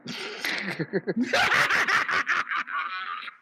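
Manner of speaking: long high soft cackle; gleeful cackle; high laugh. Real, not acted. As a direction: cackle at your own scheme. Laughing at his scheme.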